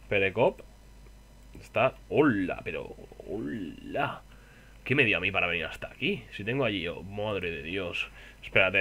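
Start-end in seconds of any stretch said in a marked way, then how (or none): none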